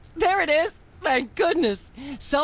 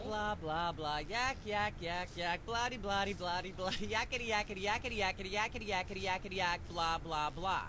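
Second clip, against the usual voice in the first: the rant trailing off very soft into blah blah; a soft droning ramble; fading rant; 3 semitones lower, 14 dB quieter than usual. Acted. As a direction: mumble the filler words far under the voice. Host rant fades into blah.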